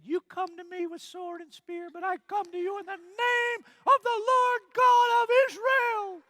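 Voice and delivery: defiant battle cry. Acted. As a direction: proclaim it over the giant. David defying Goliath, quoted.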